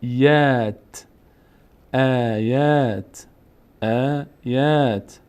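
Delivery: آیات slow, both aa vowels held long; long alef sound. Shown as a demonstration. Teacher models the word for learners, slow long vowels. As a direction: stretch each alef in آیات. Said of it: "فتح بعدش الف".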